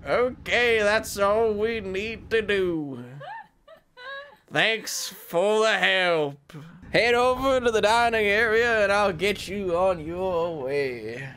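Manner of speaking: a hint of a cowboy accent, drawn out. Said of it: "Paige would be better at a cowboy voice than I am".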